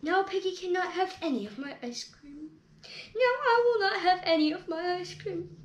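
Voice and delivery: despairing wail; hammed-up grief; lament. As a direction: mourn the loss dramatically. Lamenting dropped ice cream.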